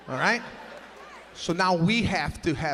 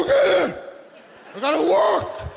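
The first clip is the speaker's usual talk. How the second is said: much higher, much louder barking father; mock yell; 13 semitones higher, 9 dB louder than usual. Father yelling at the mother over a crying baby, loud. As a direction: bellow it like a fed-up dad. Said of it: "my father would go".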